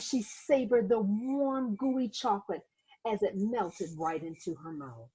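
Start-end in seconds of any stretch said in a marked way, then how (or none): none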